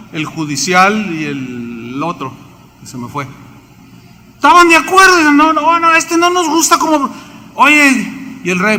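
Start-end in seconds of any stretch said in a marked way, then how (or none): 4.3-8.4 voices the rulers much higher and louder, scoffing; mocking high voice. Preacher mocks the king's men rejecting Jeremiah, in a high scoffing voice. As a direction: squeak out their complaint like whiny officials.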